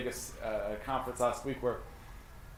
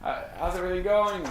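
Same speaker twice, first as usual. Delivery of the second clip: higher, breezy "hey, how's it going"; casual friendly check-in; 6 semitones higher than usual. Pretend. Agent's casual call to client Ethan, breezy and higher. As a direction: sound like a buddy just checking in. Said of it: "reach out to Ethan and check in and say".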